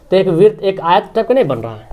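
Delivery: usual speaking voice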